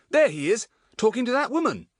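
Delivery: loud, high, urgent exclamation; excited calling out. A narrator's reading. Larry spotting Fatty with a woman, calling out excitedly. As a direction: point him out, high and loud. Larry spots the man again.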